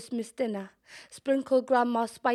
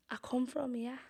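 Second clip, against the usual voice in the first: quiet reply; 3 semitones higher, 7 dB quieter than usual. Retold or imitated. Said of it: "in my perfect broken English Scouse".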